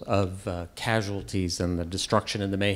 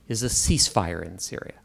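ordinary delivery

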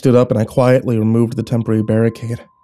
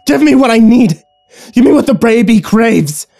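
high, loud, unhinged craving voice; crazed wife demanding what the baby craves; manic demand; 12 semitones higher, 7 dB louder than usual. Acted. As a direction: snarl it like a possessed thing.

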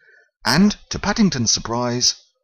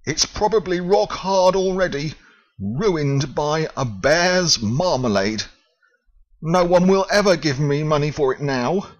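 wailed; 8 semitones higher, 3 dB louder than usual. Acted. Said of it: "he wailed".

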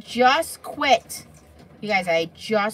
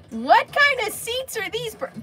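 incredulous teasing; 13 semitones higher, 4 dB louder than usual. Real, not acted. Teasing Bernie about the movie seats, high incredulous. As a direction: stretch it out in mock disbelief.

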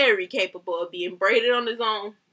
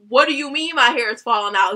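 loud, alarmed comeback; retold nervous alarm; 2 semitones higher, 7 dB louder than usual. Client questioning sister about falling hair, loud nervous alarm. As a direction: raise your voice as if starting to panic. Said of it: "It's like, I'm getting nervous".

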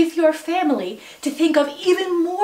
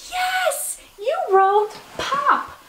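much higher, delighted cheer; excited praise; 13 semitones higher, much slower than usual. Real, not acted. Praising child's writing.

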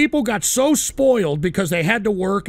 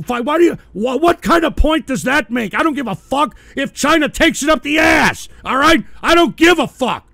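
higher, louder angry yelling; real furious shouting; 6 semitones higher, 5 dB louder than usual. Real, not acted. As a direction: blast it out in genuine outrage. Furious rant about China.

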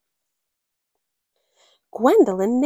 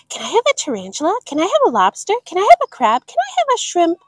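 higher and louder with each ask; building pleading; 8 semitones higher, 4 dB louder, much faster than usual. Acted. Girl begging parents, building to a high squeal. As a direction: climb from eager to squeaky.

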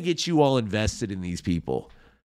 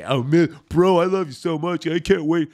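mock bro voice; 3 semitones higher than usual. Mocking podcaster bro talk.

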